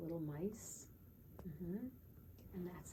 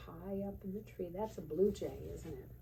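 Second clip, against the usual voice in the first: ordinary delivery, nothing marked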